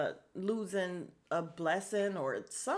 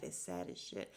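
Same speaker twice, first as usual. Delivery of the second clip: whisper; 11 semitones lower, 10 dB quieter than usual. Real, not acted. Muttered aside.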